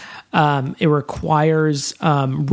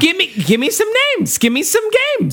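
high, cackly witch voice; 8 semitones higher than usual. Pretend. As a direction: screech it like a witch. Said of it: "Why did I sound like a witch".

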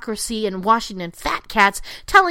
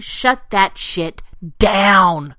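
slow, deliberate and pressed; a slow stern command; slow emphatic; 3 semitones lower, 4 dB louder, much slower than usual. Real, not acted. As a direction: drag out each word as an order. Ranting, slow order.

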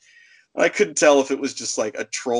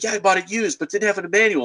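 lightly voiced caller, higher-pitched; defensive customer; 7 semitones higher, faster than usual. Woman answering the clerk about her game, mock defensive. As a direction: push back in a higher imitated voice.

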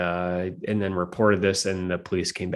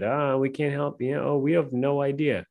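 mock cops; 5 semitones higher than usual. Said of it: "went to the cops and they said".

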